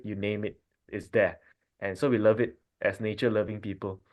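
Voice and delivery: ordinary delivery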